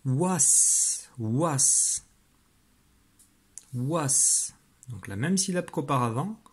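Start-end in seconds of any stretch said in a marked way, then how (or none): 0.0-4.5 "was" ending in a hissed s sound; "was" dictated crisply, final s held; dictated syllable. Shown as a demonstration. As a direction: say "was" with a long, unvoiced final s. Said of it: "alors écoute bien".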